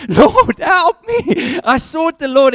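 loud, high desperate plea, acted out; crying out to God. Preacher acting David feigning madness, crying out to God. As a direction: cry out for rescue like a fearful madman. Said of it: "he was crying out to God in that moment".

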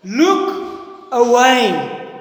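loud, very drawn-out call; screamed warning. Acted. Conscience screaming at David, long slow shout. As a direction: shout it slowly, stretching both words. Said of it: "to scream at David".

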